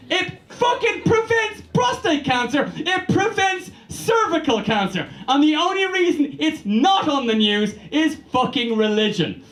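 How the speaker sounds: high, shouted rant. Real, not acted. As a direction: yell it out in disbelief.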